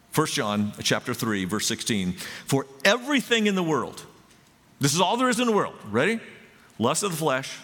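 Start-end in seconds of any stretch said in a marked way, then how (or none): 2.5-7.6 excited. Acted. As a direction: lift the verse up with fire. Preacher reads the verse loudly.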